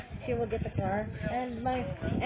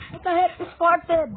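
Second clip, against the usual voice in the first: loud startled yell, higher; surprised shout; 6 semitones higher, 9 dB louder than usual. Real, not acted. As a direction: shout it out, caught off guard. Game suddenly starts.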